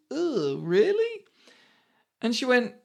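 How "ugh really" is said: slow, higher, sceptical questioning; mock dubious, stretched out and rising; dubious disgust. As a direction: imitate a child's drawn-out, unimpressed doubt. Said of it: "squints one eye and cocks his head".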